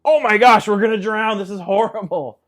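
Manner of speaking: high, loud burst of alarm that fades; mock panic. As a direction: panic like you're about to drown. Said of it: "join their panic".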